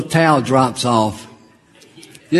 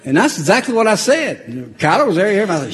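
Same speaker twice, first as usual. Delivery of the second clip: ordinary delivery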